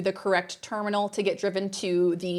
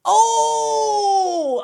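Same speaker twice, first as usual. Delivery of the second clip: long, high, loud "oh" of delight; gleeful high-pitched wail; delighted relish; 11 semitones higher, 6 dB louder than usual. Real, not acted. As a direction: savor it with a drawn-out cry. Relishing the man's humiliation.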